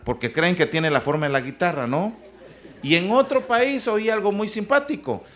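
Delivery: ordinary talk, nothing marked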